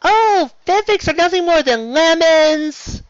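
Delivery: high, whiny mockery of fanfic haters; mocking critic. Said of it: "everybody who keeps telling me about how".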